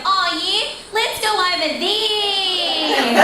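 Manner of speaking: high, drawn-out, grating Australian; hammed-up Aussie screech; obnoxious Australian accent. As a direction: put on an obnoxiously broad Australian accent, stretched out slow. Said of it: "my obnoxious Australian accent".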